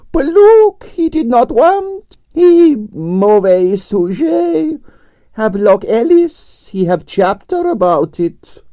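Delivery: high, slow, imperious Russian lady; grande dame Russian ballerina. A narrator's reading. Narrator voicing Arienskaya, imperious and high. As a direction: sigh it out like a tragic prima donna.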